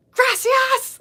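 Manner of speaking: put-on high-pitched, loud American-accented gracias; American-accented Spanish. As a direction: mimic grandpa's so-American Spanish. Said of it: "Like, so American".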